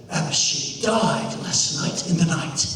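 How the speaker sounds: low, grave father breaking bad news; pitched well down, heavy and subdued; grieving dad. Father tells boy his son died, low and grave. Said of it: "he says".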